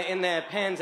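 ordinary talk, nothing marked

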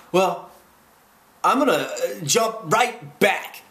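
high, loud, slowing to emphatic end; bold announcement. A narrator's reading. Humbug announcing his jump to everyone, loud and emphatic. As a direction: announce it high and loud, stretching the last words.

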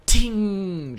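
high ringing ting; ping like a bullet on crystal; imitated metallic ting. Game master voicing the revolver shot's impact, high ting.

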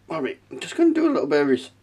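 speaking through hiccups; hiccupping while talking; suppressing hiccups. Real, not acted. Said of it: "excuse me, hiccups".

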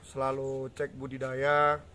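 ordinary delivery

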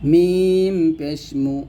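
drawn-out মিম পেশ মু with lips rounded; teacher's slow Quran letter drill; slow demo syllable. Shown as a demonstration. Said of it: "ঠোঁট গোল করবেন ততই আপনার পড়াটা সুন্দর হবে".

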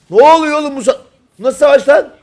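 high, loud bewildered cry; astonished shouting. Real, not acted. Caster watches a chaotic battle, astonished shouting. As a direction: yell in disbelief at the fight.

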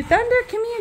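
high sing-song call to the dog; high, coaxing call to Thunder. Real, not acted. Calling the dog.

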